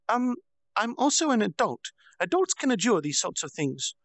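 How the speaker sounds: put-on high childish voice, stressing adult; insisting she's an adult. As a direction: insist like a child playing grown-up. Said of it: "emphasis on her being an adult".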